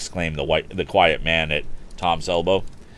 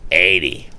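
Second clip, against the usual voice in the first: loud exclaim; 8 dB louder than usual. Acted. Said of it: "exclaimed Andy Foger".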